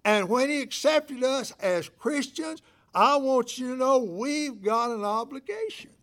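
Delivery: loud, high, ringing pulpit preaching; preaching at full force. Acted. Pastor preaching obligation to his church, full-force loud delivery. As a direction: preach it out over the congregation.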